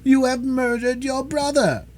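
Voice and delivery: high-pitched, wailing accusation; anguished cry. A narrator's reading. Mother accusing her daughter of dooming her brother, anguished. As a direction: wail it in despair. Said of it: "cried Madame Charpentier".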